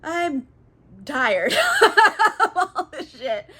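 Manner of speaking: mock-exasperated, high and drawn out; hammed-up fed-up groan; real tired. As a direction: wail it wearily, breaking into laughter. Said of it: "[name], she's real tired".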